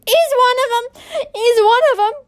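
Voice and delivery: put-on high, loud frantic old woman's cry; frail grandmother screaming an accusation; old lady crying out. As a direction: shriek it out as a desperate old lady. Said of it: "cried the old lady".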